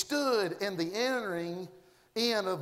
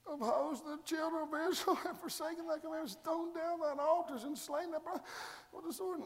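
softer, higher self-pitying prophet; Elijah complaining; 8 semitones higher, 5 dB quieter than usual. Acted. Elijah softly pleading his case to God. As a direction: whine it softly, feeling sorry for yourself.

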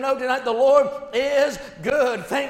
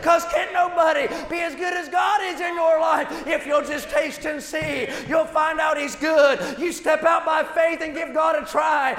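preaching at full force; 3 semitones higher than usual. Acted. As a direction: lift the room with fiery proclamation.

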